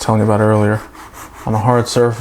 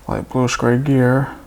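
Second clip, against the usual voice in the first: normal talking voice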